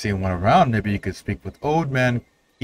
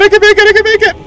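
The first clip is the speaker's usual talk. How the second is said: excited yell; 11 dB louder, faster than usual. Real, not acted. Excited yell reaching safety.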